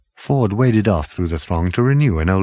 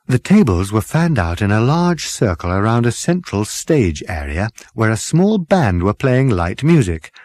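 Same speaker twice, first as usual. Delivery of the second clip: ordinary delivery, nothing marked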